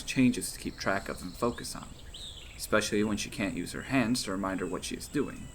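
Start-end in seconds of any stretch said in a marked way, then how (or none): none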